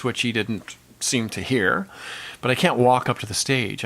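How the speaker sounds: usual speaking voice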